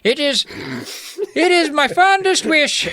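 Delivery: very high, overwrought wizard voice; hammed-up sorrowful old magician; anguished wizard. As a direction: plead tearfully in a put-on wizard voice.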